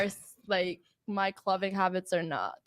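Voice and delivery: normal talking voice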